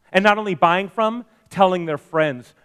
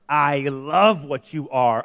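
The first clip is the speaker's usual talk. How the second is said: ordinary delivery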